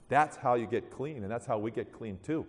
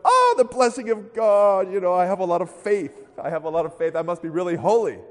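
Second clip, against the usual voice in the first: high, loud, hammed-up rapture; exaggerated religious boasting; mock pious gushing; 7 semitones higher, 10 dB louder than usual. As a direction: crow with self-satisfied holiness, mockingly. Mocks self-satisfied Americans.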